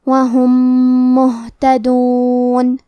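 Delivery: وہم مہتدون with a held doubled nasal meem; meem into meem with ghunna. Shown as a demonstration. Teacher demonstrates merged meem to students, slow recitation. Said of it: "غنہ اور تشدید کے ساتھ".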